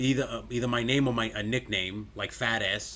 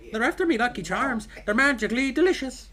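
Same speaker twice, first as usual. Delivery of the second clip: high mock-Irish cartoon voice; exaggerated high Irish lilt; Lucky Charms leprechaun; 11 semitones higher than usual. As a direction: chirp it high in a fake Irish accent. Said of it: "you're doing the leprechaun from Lucky Charms".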